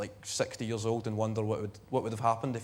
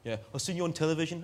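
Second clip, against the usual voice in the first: mock Leamington Spa English accent, higher pitched and quick; 4 semitones higher, faster than usual. Scottish comic mimics a Leamington Spa viewer, English accent. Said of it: "a very convincing Leamington Spa accent".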